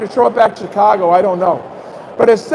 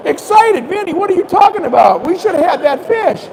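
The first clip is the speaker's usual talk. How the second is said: incredulous scolding; 7 semitones higher than usual. Retold or imitated. Guide scolds guest after lost fish.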